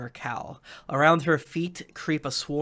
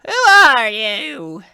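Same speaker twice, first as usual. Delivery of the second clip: slow, loud, higher witch demand; menacing witch; 9 semitones higher, 13 dB louder, much slower than usual. Acted. Necromancer confronting the intruder, slow menacing demand. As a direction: draw out the question like a threat.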